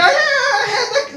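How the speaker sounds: high, whiny complaint; hammed-up whining patient; mock whining patient. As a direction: whine like a fussy patient. Impatient patient mimicked.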